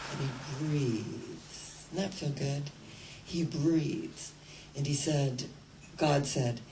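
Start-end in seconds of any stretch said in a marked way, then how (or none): 0.1-4.3 soft, slow and low; calm breathing guide. Shown as a demonstration. Preacher leads the congregation to breathe, softly slow. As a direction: ease the room into a deep breath. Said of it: "let's all take a deep breath".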